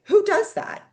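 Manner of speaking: squeaky high disbelieving question; mock disbelief. Real, not acted. Telling the host about the Andes ride, high mock disbelief. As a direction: shoot up high in mock amazement. Marvels at her own boldness.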